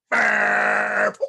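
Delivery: big booming burp sound; loud comic burp. Acted. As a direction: belt out a huge cartoon burp. Mouse burps loudly in story.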